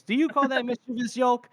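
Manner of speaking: acted pointed question, pitched high. Corporal asks his recruit to back him up, pointed. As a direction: put a subordinate on the spot, voice lifted. Tunney puts Yolk on the spot.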